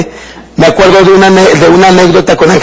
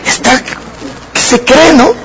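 indignant huff; 6 semitones higher, 4 dB quieter than usual. Retold or imitated. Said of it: "sí sentí el corajito de".

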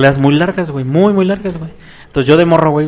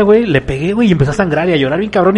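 usual speaking voice

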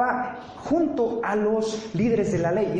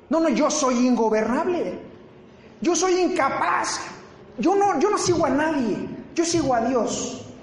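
mock stubborn defiance; 7 semitones higher than usual. Mocking a stubborn believer.

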